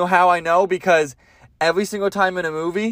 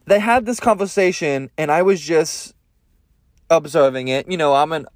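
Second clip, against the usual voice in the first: ordinary talk, nothing marked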